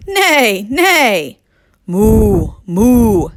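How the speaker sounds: loud neighs, then long loud moos; shouting animal noises. Acted. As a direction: shout farmyard calls. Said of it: "ran around shouting".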